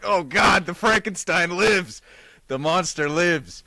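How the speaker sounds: high, melodramatic mad-scientist cry; horrified creator. Acted. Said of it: "the creator beings are like".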